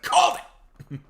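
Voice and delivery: loud, high shout of victory; triumphant shout. Retold or imitated. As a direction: burst out with a proud yell. Triumphant retold call to coworkers.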